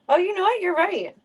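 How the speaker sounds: sudden high realization; surprised. Real, not acted. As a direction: catch yourself with a high "oh".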